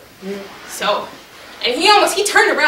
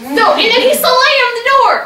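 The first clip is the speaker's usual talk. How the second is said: dramatic telling; 9 semitones higher, 4 dB louder, slower than usual. A narrator's reading. Dramatic telling of slammed door.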